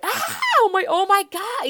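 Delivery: high mock panicked shriek of a startled girl; freaking out. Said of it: "you know, freak out mode".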